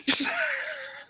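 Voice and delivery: a sudden, very high cracking laugh; rueful laugh. Real, not acted. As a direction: laugh at the irony of retirement. Rueful laugh about ending up with Hooch.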